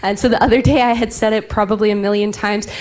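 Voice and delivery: usual speaking voice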